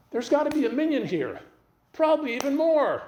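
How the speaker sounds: high-pitched emphatic insistence; worked-up, adamant retold plea; insistent. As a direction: press the point urgently, pitch way up.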